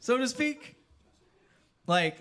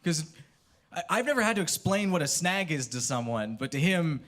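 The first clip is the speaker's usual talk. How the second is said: ordinary delivery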